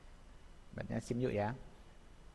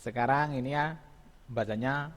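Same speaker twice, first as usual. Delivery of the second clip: normal talking voice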